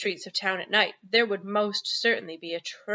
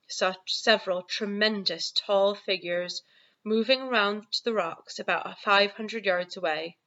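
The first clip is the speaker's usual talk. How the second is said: ordinary delivery, nothing marked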